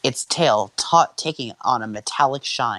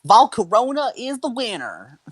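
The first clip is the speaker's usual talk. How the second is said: referee announcer voice; 10 semitones higher than usual. Acted. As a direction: declare the battle result like a referee. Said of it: "I love this voice".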